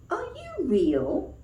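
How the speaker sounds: high, slow, wondering little rabbit; small shy rabbit voice. Acted. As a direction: ask it with timid hope. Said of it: "asked the Velveteen Rabbit".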